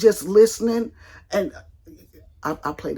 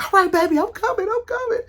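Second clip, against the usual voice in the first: husband hurrying; 14 semitones higher than usual. Retold or imitated. Husband on the phone promising to hurry, high mock voice. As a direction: play the husband rushing to help, hammed up.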